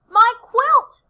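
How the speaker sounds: high, loud cry of alarm. A narrator's reading. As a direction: call out for your stolen treasure. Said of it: "cries Quincy".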